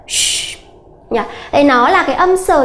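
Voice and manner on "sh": hushed, breathy "sh" like a teacher silencing a class; teacher hushing chatty pupils with sh; shushing sh. Shown as a demonstration. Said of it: "cô giáo sẽ đặt tay lên miệng".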